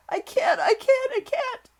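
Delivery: very high, loud panicked wailing; gasping sobs. A narrator's reading. Hysterical boy unable to stop screaming, gasping sobs. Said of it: "he gasped and sobbed".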